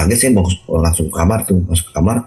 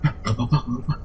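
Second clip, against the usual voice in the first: softer, slightly raised retold brush-off; hushed reassurance; 4 semitones higher, 9 dB quieter, faster than usual. Husband covering up to his wife, retold hushed reassurance. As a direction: play down the panic in a small voice.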